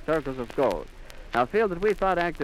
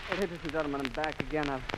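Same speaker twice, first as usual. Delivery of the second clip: shaken and quiet; 3 semitones lower, 8 dB quieter than usual. Real, not acted. Returning shaken after breaking down.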